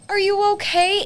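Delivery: high, loud sing-song concern; concerned asker. Retold or imitated. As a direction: ask it high and loud, fussing. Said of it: "somebody's like".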